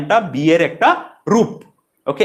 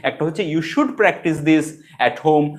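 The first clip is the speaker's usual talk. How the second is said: ordinary delivery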